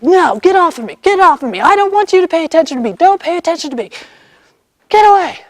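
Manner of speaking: high-pitched, urgent imitation of a screamed protest; retold screaming. Girl fighting the horse's hold, retold screaming. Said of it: "Screaming at him".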